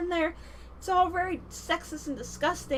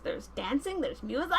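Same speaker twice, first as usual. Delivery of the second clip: ordinary talk, nothing marked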